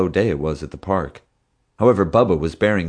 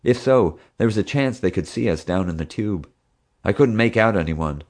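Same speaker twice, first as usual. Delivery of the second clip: ordinary delivery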